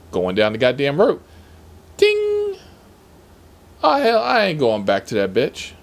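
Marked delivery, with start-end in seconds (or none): 2.0-2.6 very high, ringing "ding"; imitated phone ding. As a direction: mimic an app alert chime. Imitates the phone's ping sound.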